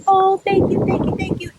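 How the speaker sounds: high-pitched, delighted mock gushing; gushing thanks. Grandmother thanks family after the birthday song, high gushing imitation.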